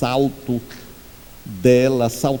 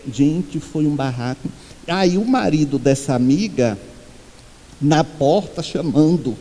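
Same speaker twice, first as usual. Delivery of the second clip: usual speaking voice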